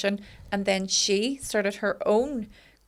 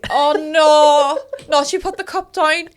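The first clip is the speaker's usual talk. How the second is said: alarmed; 7 semitones higher, 9 dB louder than usual. Real, not acted. Alarmed by friend's serious look.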